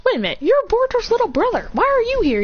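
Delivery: high-pitched acted surprise; high voice of sudden recognition; surprised questioning. Girl questions unexpected little brother.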